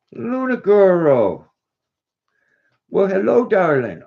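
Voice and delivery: high, slow sing-song hello to a pet; cooing to the dog. Real, not acted. Owner greeting his dog Luna in a high, drawn-out coo.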